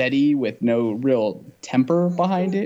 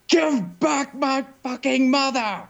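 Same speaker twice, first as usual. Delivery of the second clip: much higher, slow mock scream of the line; overacted movie yell; 14 semitones higher, slower than usual. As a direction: ham up a bad actor's outburst.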